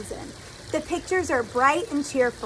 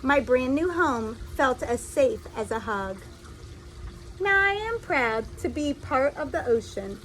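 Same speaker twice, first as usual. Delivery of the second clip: lively storybook reading voice; reading to children; 4 dB quieter than usual. A narrator's reading. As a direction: read it like a picture book to little kids. Reads a picture book aloud.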